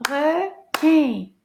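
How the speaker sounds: "Requin" in two slow, separate beats; syllable by syllable. Shown as a demonstration. Teacher counting syllables, "Requin" split into two firm beats. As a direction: clap out both syllables of "Requin". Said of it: "Combien de syllabes dans le mot requin?".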